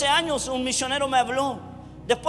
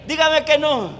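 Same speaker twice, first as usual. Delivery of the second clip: loud, high, fast call to the congregation; fiery preaching; 5 semitones higher, 11 dB louder than usual. Acted. Preacher shouting his question to the congregation. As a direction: preach it out full force to the room.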